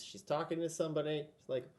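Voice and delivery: usual speaking voice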